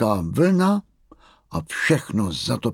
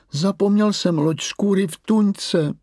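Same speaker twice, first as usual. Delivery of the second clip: dětský hlas; 10 semitones higher, 4 dB louder than usual. Acted. Mumínek si stěžuje maminka.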